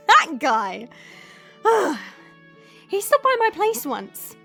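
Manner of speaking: loud, scornful exclamation with a huff; exasperated gripe. Acted. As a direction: vent about someone who annoyed you. Griping about the mayor.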